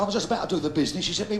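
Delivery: ordinary delivery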